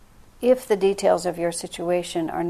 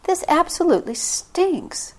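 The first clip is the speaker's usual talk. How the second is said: much higher, slow, indignant complaint; blunt complaint; 10 semitones higher than usual. Retold or imitated. Complaining out loud to Divine Mother, indignant. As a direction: spell out the frustration. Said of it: "say that really out loud".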